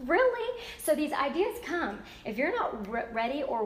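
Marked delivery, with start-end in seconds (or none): 0.1-0.6 incredulous really. Retold or imitated. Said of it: "And she".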